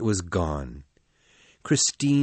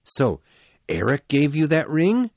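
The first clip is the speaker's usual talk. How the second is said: put-on jealous young man, higher and louder; angry young lover, raised voice; 5 semitones higher, 4 dB louder than usual. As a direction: accuse her, sharp with jealousy. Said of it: "Raoul said in an angry voice".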